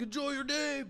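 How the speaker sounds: high, shouted farewell; cashier's booming goodbye. Retold or imitated. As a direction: shout it cheerily. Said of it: "He's like".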